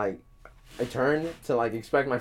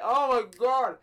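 overwhelmed reaction; 10 semitones higher than usual. Retold or imitated. Acting overwhelmed trip reaction.

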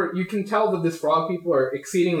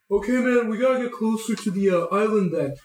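Captain Wayne; 5 semitones higher than usual. Acted. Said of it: "he turns around, he's like".

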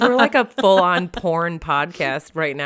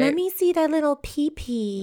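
put-on baby talk; 9 semitones higher than usual. Cutesy voiced lines.

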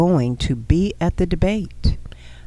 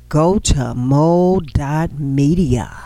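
slow emphatic ad read; 3 semitones lower than usual. Acted.

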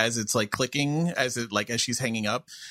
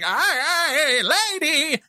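high, loud nasal-free goofy yelp; hammed-up Jerry Lewis yell; bad Jerry Lewis; 13 semitones higher, 5 dB louder, slower than usual. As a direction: squeal it like a slapstick clown. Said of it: "I was just doing my very bad Jerry Lewis".

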